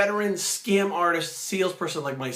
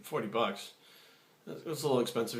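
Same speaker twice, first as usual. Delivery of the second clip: hesitant reply; 5 semitones lower, 7 dB quieter than usual. Retold or imitated. Wife balks at price, retold.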